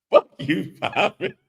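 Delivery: high laughing curse; laughing, playful high yell. Real, not acted. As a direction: cackle it out at the friend. Laughing reply to a joke.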